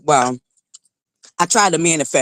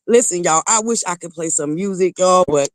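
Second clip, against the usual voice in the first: ordinary talk, nothing marked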